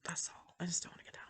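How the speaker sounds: whispered confession, voice gone. Real, not acted. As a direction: breathe it out like a secret. Confessing aside about the floor.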